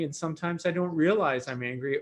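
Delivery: ordinary delivery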